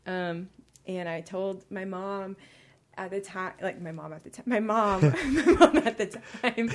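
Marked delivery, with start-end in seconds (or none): 4.4-6.7 laughing through a slip of the tongue; higher and louder, breaking into laughter; real laughing. Real, not acted. Laughs over own slip of tongue.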